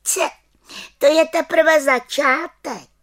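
high, loud, slow proud witch; witch boasting. A narrator's reading. Little witch boasts to the children that's only the start. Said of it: "prohlásila malá čarodějnice".

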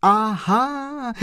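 high, drawn-out exclamation of delight; joyful cry. A narrator's reading. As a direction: cry out high in sudden triumph. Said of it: "vykřikl pan Kolbaba radostně". (radostný výkřik)

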